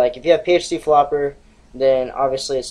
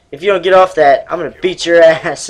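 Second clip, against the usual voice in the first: mock threatening stepdad; 3 semitones higher, 7 dB louder than usual.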